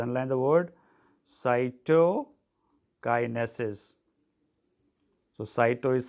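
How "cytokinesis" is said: CYTOKINESIS drawn out very slowly, in separate parts; "cytokinesis" spaced out for note-takers; slow dictation. Shown as a demonstration. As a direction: dictate "cytokinesis" slowly, pausing after "cyto". Dictates the word to be underlined.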